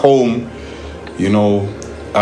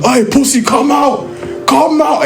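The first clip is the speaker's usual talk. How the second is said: shouting; 13 semitones higher, slower than usual. Retold or imitated. Gang outside yelling at the men holding the door, shouting. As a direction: yell come out at the guy behind the door. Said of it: "Then they shout".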